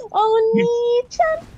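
slow, high, crying "Oni-chan"; whiny anime voice. Pretend. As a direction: whine it like a clingy anime sister. Teasing again, drawn out.

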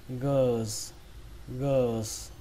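put-on "girls" as "gơ-z", buzzing final z; "girls" ending in z. Shown as a demonstration. Teacher models the other allowed ending, "girls" ending in z. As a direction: finish "girls" on a soft z. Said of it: "hoặc là âm giờ cũng được".

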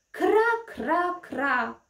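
high cawing crow call; sharp crow caws. Acted. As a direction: caw it out like a crow.

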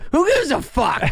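very high loud put-on voice; Farley brushing it off. Farley answering about girls, high loud cartoon voice. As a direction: squawk it in a silly high voice. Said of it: "He goes".

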